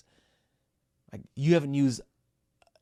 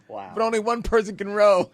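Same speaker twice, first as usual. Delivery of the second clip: ordinary delivery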